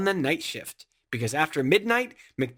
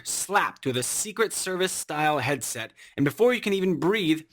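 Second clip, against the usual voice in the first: usual speaking voice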